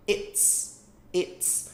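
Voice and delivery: "its" said in a whisper, like "ets". Shown as a demonstration. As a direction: breathe "its" out unvoiced, no voice. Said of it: "Percebe que fala igual aquele its". ("its" sussurrado)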